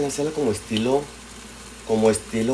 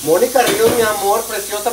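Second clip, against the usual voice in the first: high excited greeting; 10 semitones higher, 5 dB louder than usual. Real, not acted.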